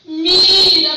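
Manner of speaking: loud, drawn-out call imitating a great voice; retold great voice. The Lord calling her to look, retold in a great voice. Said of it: "una gran voz que me decía".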